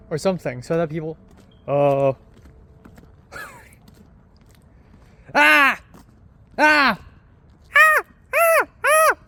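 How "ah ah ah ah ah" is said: high, loud wordless yells, each rising higher; shouting Ah. Pretend. Drunk vlogger yelling into empty streets, high piercing yells. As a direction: belt short screams into the night. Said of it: "and just shout".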